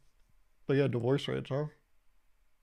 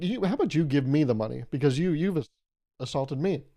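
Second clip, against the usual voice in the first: ordinary talk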